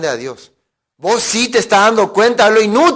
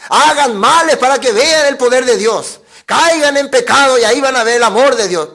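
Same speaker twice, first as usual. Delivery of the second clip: higher, forceful parody of preaching; mock false preacher; 4 semitones higher, 4 dB louder than usual. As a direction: urge the crowd like a hammed-up preacher. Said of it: "¿Pablo enseñaba así?". (prédica fingida, enérgica y aguda)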